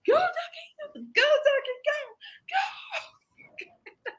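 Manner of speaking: very high, strained reenacted cheer, cracking into laughter; mock-frantic squeal urging on a rubber duck; yelling at duck. Retelling cheering her duck at the race, frantic yelling. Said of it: "I'm yelling at my duck".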